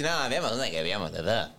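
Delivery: mock how everyone proposes a film; mock typical person. Mocking a date proposing a rewatch, low lazy mumble. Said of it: "es típico que la gente dice".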